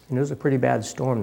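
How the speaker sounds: usual speaking voice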